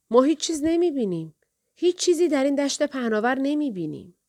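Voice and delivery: high-pitched answering call; calling back. A narrator's reading. Sisters calling back from the tower.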